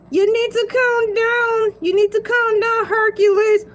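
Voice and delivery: a second voice scolding him to calm down; scolding other voice. Acted. Another voice telling Hercules the Shark to calm down.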